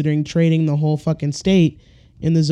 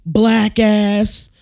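higher, forceful mock cop outburst; hammed-up hostile officer; acted aggressive cop; 5 semitones higher than usual. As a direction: order the driver around like an angry cop. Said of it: "And they're like".

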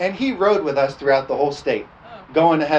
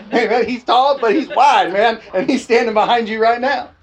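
higher, louder excited telling; 6 semitones higher, 4 dB louder than usual. Acted. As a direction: pump it up with excitement. Relaying angel message excitedly.

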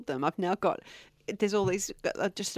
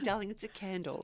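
calm, soft reassurance; 7 dB quieter than usual. Retold or imitated.

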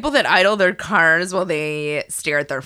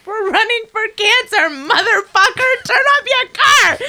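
high, loud yell at an idling driver; furious shout; 14 semitones higher, 5 dB louder than usual. Retold or imitated. Runner yelling at an old man idling his car, furious shout. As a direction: scream it at him, much higher and louder. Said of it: "So I went".

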